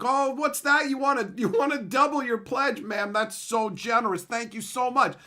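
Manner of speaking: high, loud, sugary fundraiser voice; mock gushing gratitude. Charity caller thanking donor for pledge, gushing. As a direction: put on high, effusive thanks for a customer.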